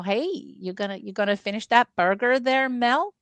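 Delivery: Minnesota lilt, folksy and casual; Fargo film accent. Acted. Quoting the Fargo film to friends, folksy Minnesota accent. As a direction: put on a mild Upper Midwest twang. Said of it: "I love that film".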